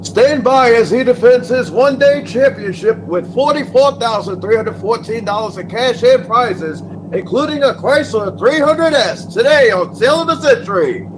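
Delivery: upbeat game show intro; announcer voice. Acted. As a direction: sell the champion like a TV promo.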